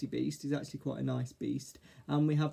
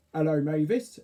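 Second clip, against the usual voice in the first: louder greeting in a lightly put-on character voice; lightly voiced Arthur; 7 dB louder than usual. A narrator's reading. Caterpillar greets the fairy in sunlight, lightly voiced.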